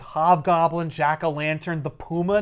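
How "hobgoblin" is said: usual speaking voice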